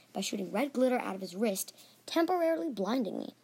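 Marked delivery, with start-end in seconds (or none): none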